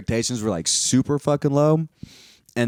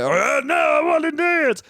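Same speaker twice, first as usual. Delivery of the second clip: mocking a dancer; 13 semitones higher than usual. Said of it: "he's like".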